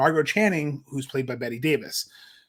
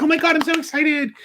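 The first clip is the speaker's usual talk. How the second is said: put-on much higher, giddy childlike squeal; little kid excitement; 12 semitones higher, 4 dB louder than usual. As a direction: squeal like a kid meeting Mickey Mouse. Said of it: "she's like a little kid".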